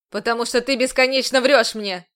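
loud furious commander; explosive outburst. A narrator's reading. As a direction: blow up at her. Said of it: "Взорвался он".

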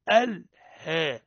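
الها drawn out with a fronted "he" vowel; fatha tilted toward kasra. Shown as a demonstration. Teacher measuring the error, fatha sliding toward kasra. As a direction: stretch الها slowly, bending the a toward e. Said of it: "نزلت من الفتحة نحو الكسر".

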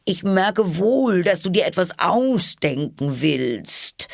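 high, slow bird voice, distrustful; see through her, slowly; high pigeon voice, accusing; suspicious pigeon. A narrator's reading.

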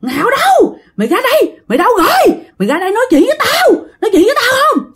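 high-pitched demanding yell; acted possessed woman shrieking for someone; screaming out. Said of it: "nó làm ghê lắm".

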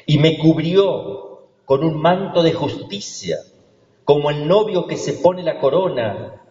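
scripture reading. Acted. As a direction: read the prophet's words as a proclamation. Reads the Isaiah text. (lectura bíblica proclamada)

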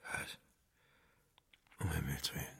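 barely voiced, very soft and low; stunned whisper. Husband learning his mother was murdered, stunned whisper. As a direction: whisper it in shock.

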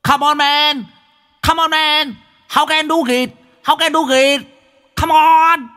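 high, hyped-up action-film shouts; very high strained English cheering; American-movie yelling. Acted. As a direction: egg him on like an American movie sidekick. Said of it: "bắn thêm mấy câu tiếng Anh như trong phim Mỹ".

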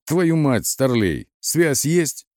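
high-pitched agitated demand; frantic yelling. A narrator's reading. Батон presses the lieutenant for communications, yelling frantically. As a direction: yell it like a man possessed. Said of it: "Батон орал как припадочный".

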